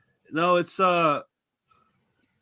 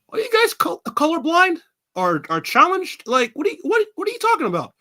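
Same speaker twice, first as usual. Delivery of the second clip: high, disbelieving mock outrage; a young boy baffled by classmates; incredulous kid; 11 semitones higher, 4 dB louder than usual. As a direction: act baffled at the other kids. Kid self mocking classmates' colors.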